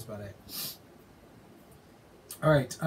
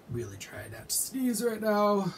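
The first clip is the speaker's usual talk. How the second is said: whispering; 8 semitones higher than usual. Real, not acted. Holding back a sneeze.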